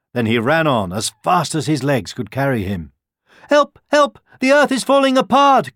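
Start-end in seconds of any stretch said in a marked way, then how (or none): 3.5-5.7 high, loud cries for help; shrieked alarm, pitched way up; crying out. A narrator's reading. Second hare spreading the alarm, crying out in panic. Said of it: "cried the other hare".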